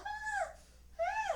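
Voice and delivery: high whimper broken by laughter; laughing dog whine. Retold or imitated. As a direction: whine like the dog, giggling through it. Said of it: "he's all".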